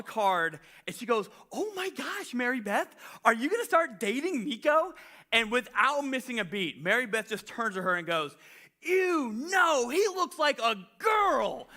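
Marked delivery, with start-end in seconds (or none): 1.5-4.9 high, gushing mock schoolgirl squeal; lightly voiced nosy girl teasing; gossipy schoolgirl. Imitating gossiping classmate girl. 8.8-11.7 high, slow mock sneer; disgusted schoolgirl. Imitating Mary Beth's rejection.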